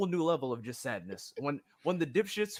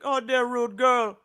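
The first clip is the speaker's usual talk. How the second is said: loud mock Jamaican accent; 9 semitones higher, 13 dB louder than usual. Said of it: "he'll just come be like".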